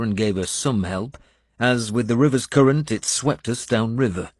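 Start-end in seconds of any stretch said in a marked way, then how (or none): none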